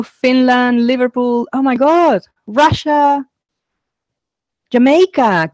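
high, bright-eyed reading out each place; delighted host reading the chat; excited greetings. Real, not acted. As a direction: greet every city like a happy surprise.

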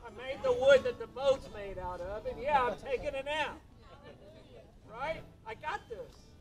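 loud, sassy duh-of-course reply; mock exasperated Jesus. Jesus tells scared disciples he's got this, loud and cocky. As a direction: toss it off like it's obvious, half-joking.

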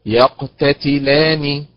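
يقتتلان slow with the lam stressed. Shown as a demonstration. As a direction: say يقتتلان slowly, hit the lam. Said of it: "إذن النبر على حركة اللام". (نبر على حركة اللام)

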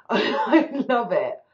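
high, loud, slow words through a laugh; giggling fan joy; laughing delight. Real, not acted. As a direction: laugh it out with glee. Gushing about the Belter accent.

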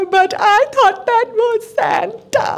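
put-on high teary little girl voice; crying child. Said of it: "She started crying".

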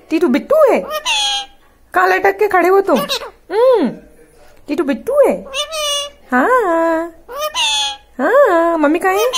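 put-on high, loud baby talk to the parrot; high pet talk. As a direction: coo loudly and high at the bird. (तोते से ऊँची, तेज़ तुतलाती आवाज़)

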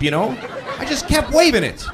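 usual speaking voice

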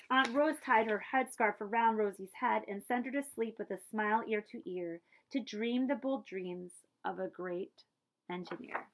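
plain reading voice

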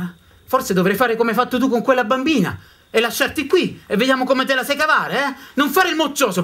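loud, high scolding grandfather; angry scolding. Acted. Grandfather scolding the boy who wants to come, loud and harsh. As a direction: bark at the crying boy to toughen him.

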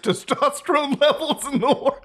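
reading while cracking up; real laughter. Real, not acted. YouTuber reads an absurd post to viewers, cracking up. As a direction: voice climbs high as laughter takes over.

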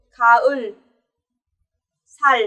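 "khaul, sal" each ending on a held L; final L. Shown as a demonstration. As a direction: finish "khaul" and "sal" with the tongue on L. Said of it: "ở vị trí cuối mình đọc là chữ L".

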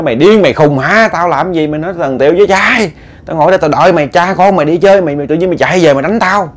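acted-out wife's tirade; angry scolding. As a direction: scold him back, voice pushed up. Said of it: "bà lên bà chửi".

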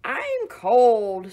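loud, squealing pig voice. Acted. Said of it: "squealed the pig".

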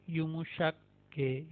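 "युमुशक गे" said slowly, syllable by syllable; soft G name. Shown as a demonstration. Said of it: "का मतलब Turkish के अंदर होता है नरम". (धीरे से "युमुशक गे")

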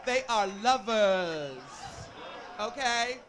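loud, slow and laughing; drawn-out camp tease; slow laughing punchline. Real, not acted. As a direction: stretch it out, laughing.

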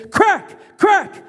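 high, punchy sound-effect crack; sharp crack cry. A narrator's reading. Narrator voices belt striking hands, high sharp crack. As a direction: snap the word out like a whip.

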